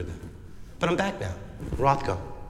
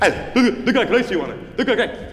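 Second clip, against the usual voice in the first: laughing Pacino; 8 semitones higher than usual. Acted. Scarface bit, laughing.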